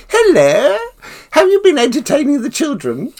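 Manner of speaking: high, loud, refined royal voice; imitating the Queen. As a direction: put on a gracious queenly voice. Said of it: "She said".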